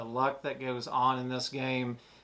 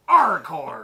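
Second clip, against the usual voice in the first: loud, high pirate yell; pirate shout; 9 semitones higher, 16 dB louder than usual. Pretend. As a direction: bellow it like a rowdy pirate. Pirate chant.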